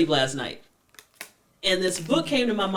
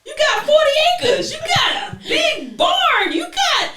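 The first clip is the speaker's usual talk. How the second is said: much higher, louder, laughing mock shout; grandmother voice; 7 dB louder than usual. Grandmother laughing off the bride's wish for love, loud high shout. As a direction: scoff at love, hollering and cackling. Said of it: "the grandmother was like".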